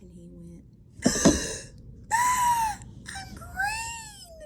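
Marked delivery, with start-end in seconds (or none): 0.7-2.1 big, loud shocked gasp; dramatic gasp. Acted. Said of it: "remember to gasp". 2.1-4.4 very high, loud panicked cry; hammed-up little ghost scream; horrified shriek. Ghost discovers he turned green.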